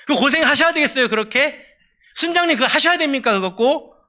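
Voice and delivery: high-pitched, acted-out pestering; nagging discouragement. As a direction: play the scoffer needling a believer. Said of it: "주변에서 가만두질 않습니다".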